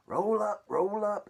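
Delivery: high, loud fairground yell; carnival barker yell. Acted. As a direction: bellow it out to draw the crowd. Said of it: "Aunt Spiker yelled".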